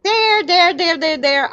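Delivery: high sing-song repeats; pointing woman. Retold or imitated. Woman giving vague directions, high sing-song there there. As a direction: point every which way. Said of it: "She's like".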